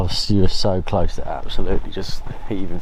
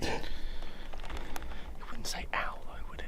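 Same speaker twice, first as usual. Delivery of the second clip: whispering; 17 dB quieter than usual. Real, not acted. Whispering about screams in woods.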